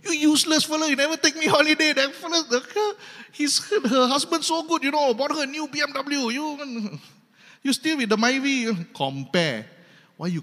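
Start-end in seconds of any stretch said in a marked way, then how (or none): 0.1-8.7 high, fast mock nag of a useless husband; shrill-free high mock complaint, rushed; nagging wife. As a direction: whine it like a wife who wants a BMW.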